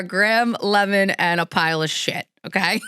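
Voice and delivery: usual speaking voice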